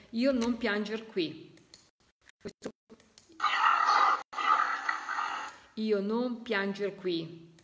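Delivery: slow, stressing non; slow emphatic repeat. Shown as a demonstration. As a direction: lay out each word for listeners. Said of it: "Vedete come specifica bene". (lento, con enfasi su non)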